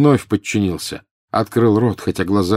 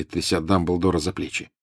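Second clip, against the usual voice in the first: ordinary delivery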